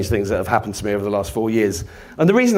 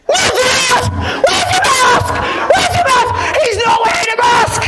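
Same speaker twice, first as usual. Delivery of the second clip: put-on high, screeching yell of an outraged old man; imitating a frantic shouted telling-off; shouting old man; 4 dB louder than usual. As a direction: yell the accusation at full pitch, very high. Acted old man yelling at speaker.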